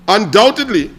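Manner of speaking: usual speaking voice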